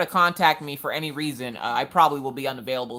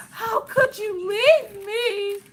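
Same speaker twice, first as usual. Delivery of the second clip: hammed-up sob, much higher, slow and wailing; mock crying; 4 dB louder, much slower than usual. Clingy wife to leaving husband, mock sobbing wail. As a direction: whine it like an abandoned puppy. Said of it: "and I'm like".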